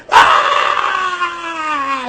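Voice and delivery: high mock battle yell; a hammed-up high yelp. Vlogger mocking the wrestler's wild cry, high yelp.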